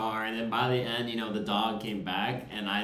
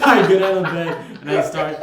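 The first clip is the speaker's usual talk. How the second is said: laughing; 8 semitones higher, 14 dB louder than usual. Real, not acted. Laughing at the memory.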